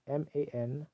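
"M A N" spelled letter by letter. Shown as a demonstration. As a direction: spell "M A N" out one letter at a time. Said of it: "not angel".

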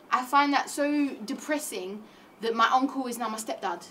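ordinary talk

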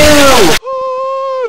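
shrill high ooh; high squeal. Pretend.

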